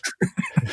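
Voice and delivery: very high-pitched laugh; short high burst of amusement; laughter. Real, not acted. Cohost amused by the host's failed impression, laughter.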